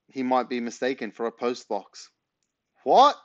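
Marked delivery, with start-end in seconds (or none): 2.8-3.2 high, loud startled shout; Dad shouting up, high loud What; shouted question. Acted. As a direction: bark a high shocked What. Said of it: "cried Dad".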